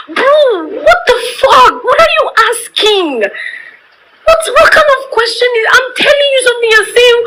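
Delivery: upset outburst near tears. Real, not acted. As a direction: fire it off fast, half crying with rage. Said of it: "I feel like crying".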